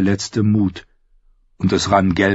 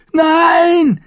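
very high, loud panicked shriek; loud screech; 7 dB louder than usual. A narrator's reading. Captive about to be hanged, shrieking in panic. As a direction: screech it out in terror. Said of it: "kreischte Merritt laut".

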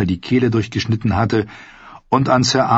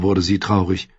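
ordinary delivery, nothing marked

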